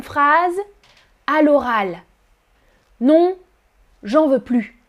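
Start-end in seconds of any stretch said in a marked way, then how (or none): 4.0-4.8 "j'en veux plus" with no "ne", casual; dropped ne. Shown as a demonstration. Teacher shows spoken French without the ne. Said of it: "on supprime le ne".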